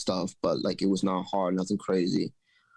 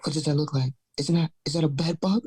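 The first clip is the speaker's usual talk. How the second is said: higher worried imitation of girlfriend; alarmed girlfriend; 5 semitones higher than usual. Girlfriend showing him the bed bug picture, higher alarmed imitation. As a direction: imitate her alarmed question, pitched up. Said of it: "She's like".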